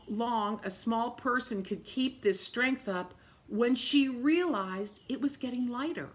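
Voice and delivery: plain reading voice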